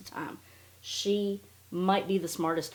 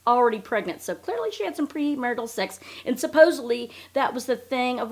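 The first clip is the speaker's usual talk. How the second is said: ordinary talk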